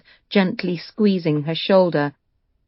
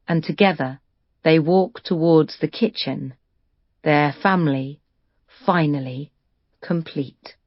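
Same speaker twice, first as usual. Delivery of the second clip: ordinary delivery, nothing marked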